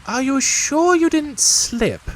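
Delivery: high, slow knowing question; know-it-all rabbit; wise Rabbit voice. Acted. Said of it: "asked Rabbit wisely".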